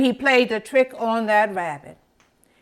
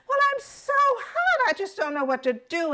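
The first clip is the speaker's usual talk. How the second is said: high, fast squirrel voice, fretting about the heat; little squirrel's high fretful whine; hot squirrel; 12 semitones higher, 3 dB quieter, faster than usual. Acted. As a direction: squeak it out like a small animal overheating.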